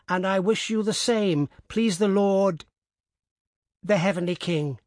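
put-on high old woman, steady and formal; old woman dictating. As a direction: dictate the blessing evenly.

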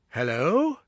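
hello called out with a rising tune; calling out. A narrator's reading. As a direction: call out hello, questioning. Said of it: "he cried".

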